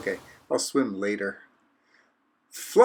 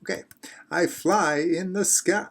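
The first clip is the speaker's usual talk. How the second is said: ordinary talk, nothing marked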